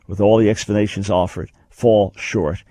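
normal talking voice

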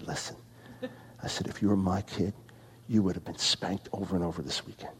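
very quiet, low, measured threat; low stern warning. Retold or imitated. Large man standing over a complaining little kid, hushed stern warning. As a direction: lean in low and soft, quietly menacing.

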